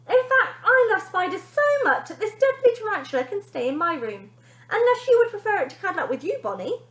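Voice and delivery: put-on high, loud taunting boy voice; little brother taunting his sister; cheeky little boy. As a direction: tease your sister sweetly.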